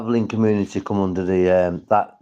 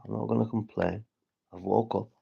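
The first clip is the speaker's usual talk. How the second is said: usual speaking voice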